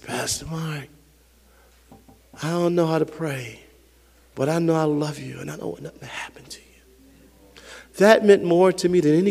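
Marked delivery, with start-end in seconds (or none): 0.0-7.6 imitating a small, hushed child on the phone; little boy's voice. Little boy phoning his sick pastor, hushed imitation. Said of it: "this little voice I hear".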